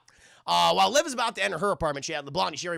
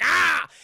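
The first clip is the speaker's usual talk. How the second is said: mock yell; 10 semitones higher, 8 dB louder than usual. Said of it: "And he's like".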